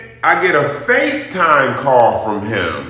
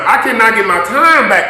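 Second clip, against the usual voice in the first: raised, hard insistence; louder indignant; 6 semitones higher, 5 dB louder than usual. Real, not acted. Angry vlogger tells viewers her time is lost. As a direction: press the point loud. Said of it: "repeated complaint".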